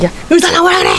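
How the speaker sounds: high, yelling for the doctor; furious patient yelling for the doctor; shouted demand. Retold or imitated. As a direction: bark it across the lobby. Patient storms into hospital.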